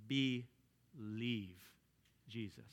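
BELIEVE and Jesus low, quiet and drawn out; stressed phrase. Shown as a demonstration. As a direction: lean hard on Believe. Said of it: "I would say it like this".

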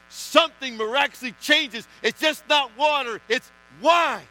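loud, high, climactic preaching; rising, loud revival preaching; fiery preaching. Acted. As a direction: build the sermon to its peak. Preaching builds to full force.

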